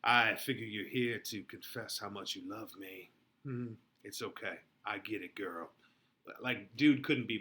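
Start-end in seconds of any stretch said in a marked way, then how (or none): 0.0-5.7 mock smug suitor, lower and softer; cocky Paris. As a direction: lean back and play the know-it-all boyfriend. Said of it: "almost like".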